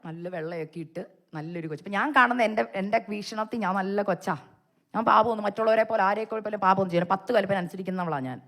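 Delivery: usual speaking voice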